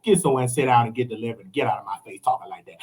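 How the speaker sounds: low, soft, fast dismissal; dismissive brush-off. Real, not acted. Speaker to [name], a low, fast dismissive brush-off. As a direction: wave him off quickly and low.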